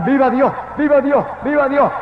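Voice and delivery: repeated high-pitched bursts of praise; retold ecstatic shouting. Wife retelling involuntary praise to God, high shouting. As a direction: cry it out suddenly, over and over. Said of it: "empecé a gritar".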